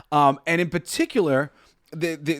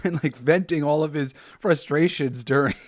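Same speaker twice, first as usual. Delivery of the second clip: usual speaking voice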